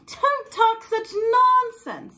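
high, slow scoffing; brusque dismissal. Acted. Woman dismissing pirate's proposal, high and slow. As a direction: brush him off, secretly pleased. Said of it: "said the fierce little woman".

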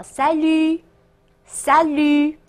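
Salut ending on a long open vowel; silent final consonant. Shown as a demonstration. Teacher shows the silent ending of Salut, slow and drawn out. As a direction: stretch Salut slowly, no final T sound. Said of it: "D在结尾是不发音的".

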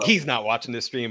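ordinary delivery

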